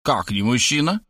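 plain reading voice

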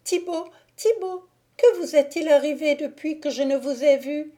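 put-on shocked young woman crying out; frightened cry. A narrator's reading. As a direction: recoil in alarm as you call his name. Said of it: "dit-elle en se reculant".

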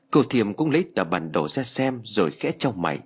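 ordinary delivery

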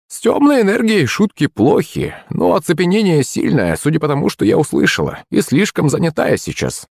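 high crone voice, reluctant; old witch, hedging. A narrator's reading. Witch putting off a request for help, reluctant. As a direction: explain like a busy old woman.

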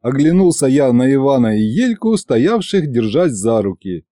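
ordinary delivery, nothing marked

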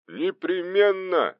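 high, long-drawn assurance; emphatic, drawn-out reassurance; assuring. A narrator's reading. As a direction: promise it, stretching the word out high. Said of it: "заверил меня Захаров".

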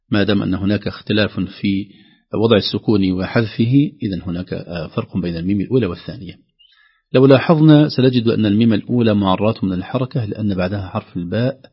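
ordinary talk, nothing marked

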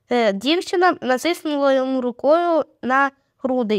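ordinary delivery

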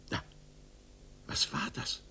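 hushed startled whisper. A narrator's reading. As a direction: freeze and breathe the question. Sudden eerie moment in story.

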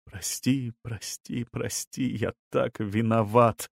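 hushed, pleading lament; soft penitent wail. A narrator's reading. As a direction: beg forgiveness under your breath, swelling at the end. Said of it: "мужчина причитал".

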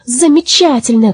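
high-pitched delighted exclamation; excited, lifted voice; gleeful cry. A narrator's reading. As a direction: cheer as if jumping for joy. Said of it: "кричали они, подпрыгивая".

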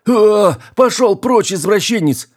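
very high, outraged yell; loud scream. A narrator's reading. Engineer screams at captain caught beside him, outraged. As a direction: shriek it to drive him off. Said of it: "громко закричал".

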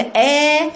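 exaggeratedly light "آ", demonstrated as a mistake; jaw-dropped thin hamza. Shown as a demonstration. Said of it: "صوت كله نازل في فك السفلي".

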